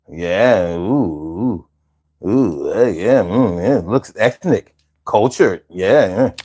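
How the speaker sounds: low appreciative murmurs; deep, impressed murmuring; lightly voiced fashion critic; mock admiring. Admiring necklace in put-on voice.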